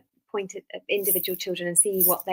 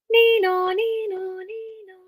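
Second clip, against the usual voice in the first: very high two-tone siren fading away; high vocal nee-naw, loud then trailing off; imitated siren; 14 semitones higher, 4 dB louder, slower than usual. Shown as a demonstration. Teacher offering a sound to copy in a song, siren imitation. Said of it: "we make a sound either vocally".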